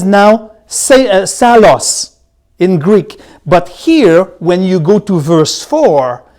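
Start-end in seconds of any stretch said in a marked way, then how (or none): none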